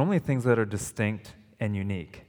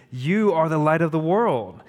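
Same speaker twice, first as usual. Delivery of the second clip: You punched higher and louder; emphatic You, raised and loud; stress on you; 5 semitones higher, 4 dB louder than usual. Shown as a demonstration. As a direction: lean hard on You, as in you alone. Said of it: "is in the emphatic position".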